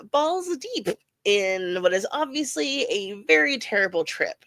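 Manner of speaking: ordinary talk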